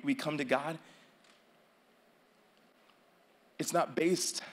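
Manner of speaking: quiet, low closing prayer over music; soft altar call. Acted. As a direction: invite them gently with heads bowed.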